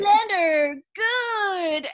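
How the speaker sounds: high, sing-song hypnotist chant; hammed-up villain brainwashing in a high voice; Mugatu. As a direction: draw it out, high and silly. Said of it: "video of Mugatu dancing around".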